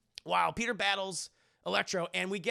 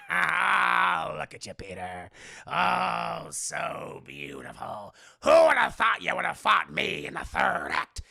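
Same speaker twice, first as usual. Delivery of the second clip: gloating goblin, dropping very low then bursting loud; creaky villain purr rising to a shout; Willem Dafoe; 3 semitones lower, 7 dB louder, slower than usual. Acted. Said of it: "the Dafoe voice because I like it better".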